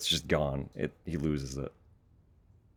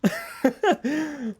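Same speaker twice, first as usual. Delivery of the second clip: very high squealing laugh; 6 dB louder than usual. Real, not acted. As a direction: let out a high shriek of laughter. Laughing after long pause.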